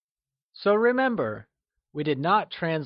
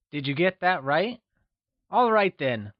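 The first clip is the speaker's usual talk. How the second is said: ordinary talk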